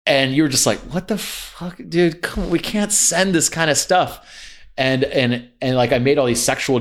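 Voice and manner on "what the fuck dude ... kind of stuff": higher-pitched, drawn-out disbelief; retold shocked protest, pitched up; incredulous mock protest. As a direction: stretch out a stunned objection. Acting out friend's shocked reaction.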